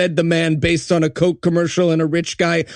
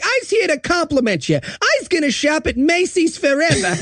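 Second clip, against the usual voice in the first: put-on old-timey lady voice; 8 semitones higher than usual. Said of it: "to be like".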